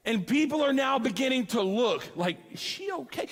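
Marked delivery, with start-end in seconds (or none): 2.5-3.3 soft, higher concerned murmur; worried onlookers. Retold or imitated. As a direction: ask it softly, eyebrows up.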